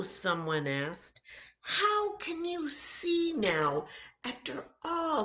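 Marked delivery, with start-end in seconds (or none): 1.6-5.2 high, wondering question; amazed asking. Acted. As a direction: ask in disbelief. Amazed onlooker asks.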